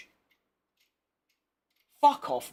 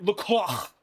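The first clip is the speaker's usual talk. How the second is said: mock-French disgust, throaty ending; disgusted French accent; 2 semitones lower than usual. Streamer imitating a driver's radio outburst, disgusted French accent. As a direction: spit out the name in a French accent. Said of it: "disgust in his French accent is outrageous".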